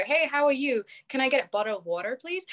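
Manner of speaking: put-on American accent. Shown as a demonstration. Brit ordering water in US, put-on American accent. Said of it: "clearly change my accent around".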